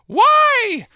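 high, loud cry of shock; retold scream. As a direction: shriek it like you've just been stabbed. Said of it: "He just screamed".

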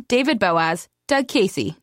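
usual speaking voice

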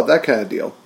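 ordinary delivery

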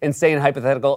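ordinary talk

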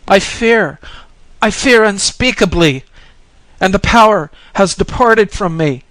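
high, loud cry of fear; terrified shriek. A narrator's reading. Pilgrim to the Bodhisattva as power leaves him, frightened shriek. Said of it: "But the pilgrim shrieked".

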